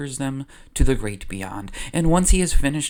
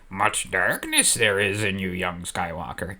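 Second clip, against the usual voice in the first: put-on lower, odd-timbred old Jedi master; Yoda voice; 5 semitones lower than usual. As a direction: croak it out like a tiny ancient sage. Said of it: "Yoda is like".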